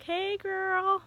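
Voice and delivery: very high, singsong imagined voice; sassy greeting. Retold or imitated. Imagined friend welcomes the new arrivals, singsong greeting. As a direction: play a friend calling hello, high-pitched.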